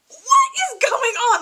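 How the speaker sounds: high, loud shocked shout; shocked outcry. Retold or imitated. Viewer reacting to the hairpin stabbing, shocked shout. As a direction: yell it in total disbelief. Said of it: "I was so shocked by that".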